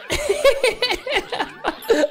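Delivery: long helpless squeal of laughter; high wheezing laugh. Real, not acted. Player sabotaged by Jimmy, long helpless high laugh.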